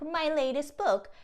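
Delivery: ordinary talk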